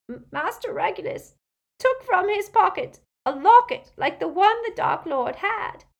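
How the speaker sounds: high, loud, stammering through tears; tearful house-elf. A narrator's reading. Weeping elf tells of his master's locket, tearful high voice. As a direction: weep out the elf's story. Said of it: "tears pouring down either side".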